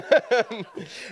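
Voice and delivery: quick high giggle after a dark aside; short laugh. Real, not acted. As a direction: laugh it off, slightly embarrassed. Nervous laugh after dark topic.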